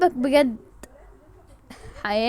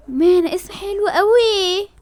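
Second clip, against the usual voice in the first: gasping mock sob; 8 semitones higher, 5 dB louder, slower than usual. Overwhelmed by name.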